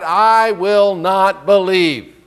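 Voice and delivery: "I will not believe" spelled out very slowly; slow stubborn refusal. Retold or imitated. Thomas insisting to the disciples, stubbornly slow. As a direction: dig in, word by word.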